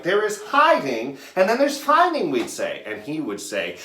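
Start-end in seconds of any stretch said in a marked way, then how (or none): 0.0-2.3 indignant playground protest, pitched way up; mad retort. Retold or imitated. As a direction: argue back like an exasperated kid. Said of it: "we would get mad back".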